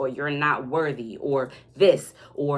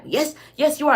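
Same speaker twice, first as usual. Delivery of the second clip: high, loud, knowing "yes, yes"; mock insistent reply; 10 semitones higher, 5 dB louder than usual. The other person insisting you are mad, in a high mock voice. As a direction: push back high and insistent.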